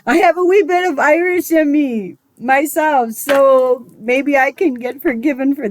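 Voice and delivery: high, lilting mock-Irish brogue; hammed-up Irish accent, pleading for forgiveness; playful Irish accent. Puts on Irish brogue to apologise.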